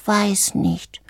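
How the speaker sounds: small, soft tearful voice; mildly sobbing. A narrator's reading. Little bird answering the frog, quiet hint of sobs. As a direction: say it softly, understated sobbing. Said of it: "sagte das Vögelchen schluchzend".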